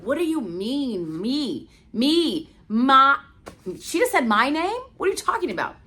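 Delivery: can't believe it's her; stunned disbelief. Retold or imitated. Called on stage, she reels in disbelief.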